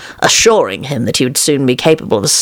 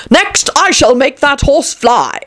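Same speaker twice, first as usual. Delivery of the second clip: slow, high-pitched royal proclamation; crying out, king; 10 semitones higher than usual. A narrator's reading. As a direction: proclaim the next trick grandly, drawn out. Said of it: "cried the king".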